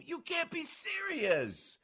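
very high, soft, drawn-out disbelief; incredulous. Real, not acted. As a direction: squeeze it out high and slow, in disbelief. Incredulous reaction mid rant.

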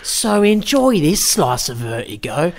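high, slow hammed-up Cockney voice; mock Cockney Hitchcock. Hitchcock presents Vertigo to the audience in mock Cockney. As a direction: ham up a London geezer showing off.

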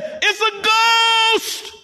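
high, long-stretched shout of alarm; frightened outcry. Acted. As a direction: wail it out as acted terror. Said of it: "they cried out for fear".

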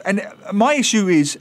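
normal talking voice

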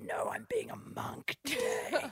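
matter-of-fact eerie whisper; monk whisper. Retold or imitated. Child explains to her worried teacher in a whisper. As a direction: breathe it out as a creepy little monk. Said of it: "She said".

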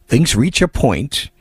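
normal talking voice

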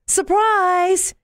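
high, joyful cry of surprise; excited shout. Acted. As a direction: mom springing the surprise. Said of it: "yelled Mom".

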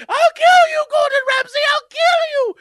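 very high, loud hammed-up scream; mock villain shriek. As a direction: squeal the threat like a cartoon maniac. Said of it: "It's like".

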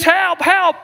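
call for help. Acted. Bodybuilder in the lion's den calling out, high mock panic. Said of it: "he says".

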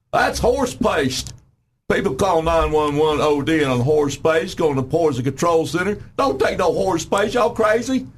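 high, slow mocking voice of officials. Talk guest mocking UAMS officials, high and slow. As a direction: mimic the scaremongering health officials.

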